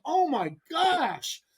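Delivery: slow, high-pitched dismay; long-drawn reenacted groan of frustration; retold exasperation. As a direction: draw it out in exasperated disbelief. Retold exasperation at rivals' antics.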